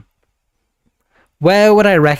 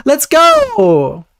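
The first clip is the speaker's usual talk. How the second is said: excited cheer; 12 semitones higher than usual. Real, not acted. Cheering viewer's news.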